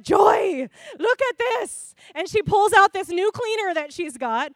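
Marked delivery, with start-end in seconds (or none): 0.0-1.9 imitated mom calling out, high and loud; excited mom. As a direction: call your daughter over to see your find. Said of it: "she's like".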